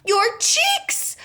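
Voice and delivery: very high cry of alarm; a mother screaming at the sight; screamed. A narrator's reading. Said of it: "screamed Missus Beauregard".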